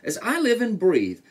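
high, delighted astonishment; amazed elephant. A narrator's reading. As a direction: marvel as the storybook elephant. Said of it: "laughed the elephant".

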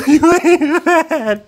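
very high, long-drawn cry; wild taunting screech. Real, not acted. Player taunting Freddy, drawn-out high screech. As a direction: stretch it high into a cackling screech.

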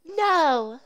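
put-on high squeaky little-girl "no"; girly kid voice. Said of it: "That sounds like a kid".

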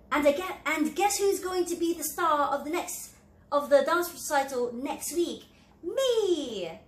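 high, loud, fast boasting chatter; bragging. Acted. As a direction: show off instead of apologizing. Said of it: "another example of not being sincere".

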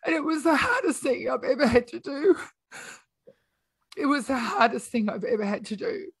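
holding back a sob; real grief in the voice; tearful. Real, not acted. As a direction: voice cracking, close to tears. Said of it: "I never planned to cry on your podcast".

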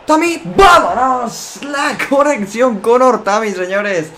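drawn-out, high, loud goal call; excited goal shout. Real, not acted. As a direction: belt out the goal like a commentator. Goal celebration commentary.

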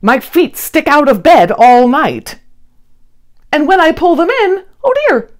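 lightly voiced fussing little creature; complaining Ned. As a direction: fret about the too-small bed. Ned complains about his small bed.

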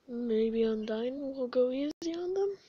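usual speaking voice

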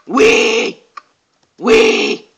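high, piercing piggy wee; loud, playful squeals. Pretend. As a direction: squeal it like a little pig. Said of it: "I sound like a pig".

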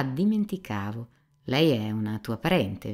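ordinary delivery

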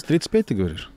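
ordinary delivery

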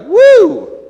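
high, loud joyful woo; excited whoop. Retold or imitated. Mimicking how the congregation uses Hosanna, joyful whoop. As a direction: whoop it like a cheering crowd. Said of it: "it means something like".

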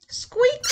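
high squeak; a high creaky door squeak; squeaky door. A narrator's reading. Door sound effect.

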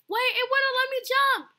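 high, loud protest; frustrated yell. Real, not acted. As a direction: protest loudly at the game. Frustrated by game controls.

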